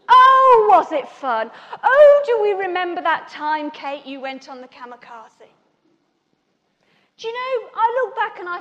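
loud, crowing tease with a big drawn "Oh"; hammed-up gloating, booming then settling; mock reminiscing. The men teasing Kate about the slide, mock reminiscing. Men's teasing after her mishap, voiced.

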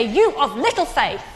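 ordinary talk, nothing marked

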